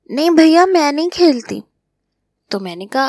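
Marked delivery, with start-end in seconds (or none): 0.1-1.6 higher and louder, protesting; flustered refusal. Acted. Maid refusing the boys, a raised protesting cry. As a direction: push back hard, pitched high.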